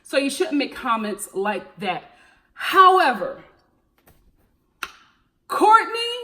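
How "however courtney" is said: loud, high dramatic "However... Courtney"; loud exasperated call-out. Real, not acted. Vlogger calling out Courtney to her viewers, loud exasperated. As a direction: drag out the pause, then call her name up high and loud.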